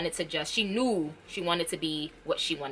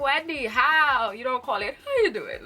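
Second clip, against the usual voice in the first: mock disbelief to Wendy; 5 semitones higher than usual. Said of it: "I was like".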